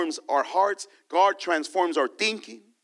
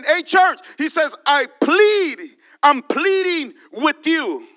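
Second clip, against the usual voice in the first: earnest plea, louder and slower; Paul pleading; 6 semitones higher, 6 dB louder than usual. Acted. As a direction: beg the church like Paul.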